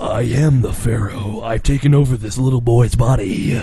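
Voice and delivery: low, grand, pompous voice; mock-regal deep proclamation; the Pharaoh. Friend parodying a possessed hero, low and grand. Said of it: "when I'm not the Pharaoh, I talk like this".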